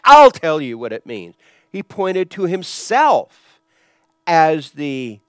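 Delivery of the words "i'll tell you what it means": emphatic, loud voice of authority; Jesus claiming authority. Retold or imitated. Jesus explains the text to the synagogue, loud and authoritative. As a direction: declare it firmly, hitting it hard. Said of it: "then he would say".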